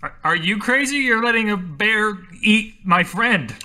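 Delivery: high-pitched indignant retort; outraged. Acted. Acted quarrel, Mateo outraged at Elsie.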